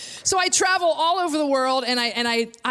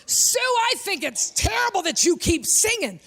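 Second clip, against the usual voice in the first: higher-pitched indignant scolding; heckling church woman; 6 semitones higher than usual. Retold or imitated. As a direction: heckle the singer in righteous disapproval. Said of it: "starts heckling me".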